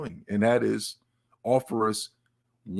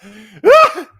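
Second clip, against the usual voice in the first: short loud burst of laughter; 9 semitones higher, 16 dB louder than usual. Real, not acted. Streamer laughing that nobody cared, loud short laugh. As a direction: bark out one laugh. Laughs at chat ignoring him.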